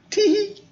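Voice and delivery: very high squeaky tee-hee; a tiny high giggle. Shown as a demonstration. Reading the picture to children, a squeaky high giggle. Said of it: "like they're giggling".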